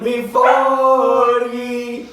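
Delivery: slow, high, booming announcer; dramatic announcer voice. Pretend. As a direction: stretch it out like a game show host. Round announcement in put-on voice.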